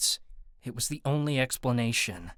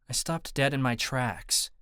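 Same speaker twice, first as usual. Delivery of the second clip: plain reading voice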